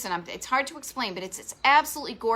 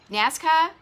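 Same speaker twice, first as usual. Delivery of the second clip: Southern NASCAR; 6 semitones higher, 6 dB louder than usual. Retold or imitated. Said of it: "So you pronounce it like that in the South too?".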